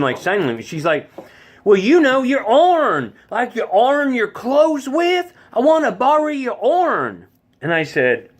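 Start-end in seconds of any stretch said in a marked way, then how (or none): 1.6-7.3 high, slow, twangy Tennessee explanation; mountain Tennessee accent. Retold or imitated. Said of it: "born and bred Tennessean from the mountains".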